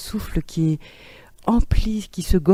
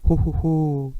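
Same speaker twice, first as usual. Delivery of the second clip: resonant ho ho. Shown as a demonstration. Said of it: "en stéréo, quadriphonie".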